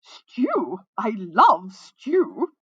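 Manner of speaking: loud, very drawn-out flamboyant call; melodious ringing voice. A narrator's reading. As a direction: let it ring out melodiously, stretching each word. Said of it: "a voice rang out melodiously".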